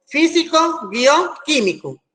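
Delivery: slow dictation, high start; dictating. Shown as a demonstration. Teacher asking where the tildes go, dictating slowly. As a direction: spell out the compound word slowly. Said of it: "pongo a ustedes la palabra".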